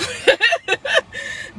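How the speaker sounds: a high, loud burst of laughter. Real, not acted. Vlogger laughing at her own coy hint, a high laugh. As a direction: laugh it off, knowing and amused.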